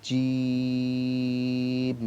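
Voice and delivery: long drawn-out জিম, vowel held; slow letter recital. Shown as a demonstration. Teacher drawing out জিম for students to repeat. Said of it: "আমার সাথে সাথে আপনারাও পড়বেন".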